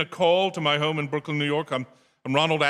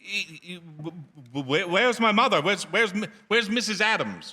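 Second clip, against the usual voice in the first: panicked stammer; 7 semitones higher than usual. Acted. Son on the phone, frantic to find his mother. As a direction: trip over the words as dread takes hold.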